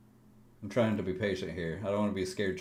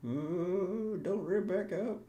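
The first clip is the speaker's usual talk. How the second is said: high pleading; 10 semitones higher, much slower than usual. Real, not acted. Pleading with chart.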